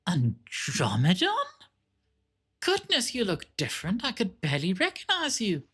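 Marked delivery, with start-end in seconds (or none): 2.6-5.6 put-on high, fussy old sister; shrill old nun.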